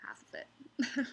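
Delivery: whispered dismay. Real, not acted. Dismayed aside about comp.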